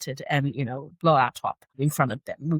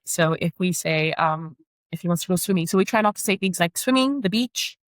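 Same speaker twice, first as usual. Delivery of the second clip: normal talking voice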